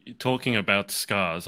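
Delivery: ordinary talk, nothing marked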